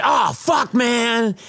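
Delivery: high-pitched mock dismay; bummed out. Delivery man learning of a friend's big fee, mock dismayed. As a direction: groan it high, imitating someone gutted. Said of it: "it's bummed him out ever since".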